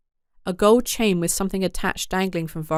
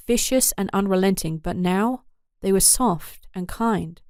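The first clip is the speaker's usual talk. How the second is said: ordinary delivery, nothing marked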